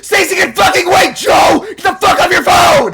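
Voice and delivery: high, full-volume yell; furious shout. Acted. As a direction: roar at a driver glued to the phone. Yelling at the distracted driver.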